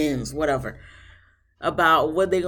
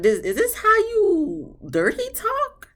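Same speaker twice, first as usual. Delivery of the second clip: incredulous; 3 dB louder, slower than usual. Real, not acted. Reacting to airy dirty talk.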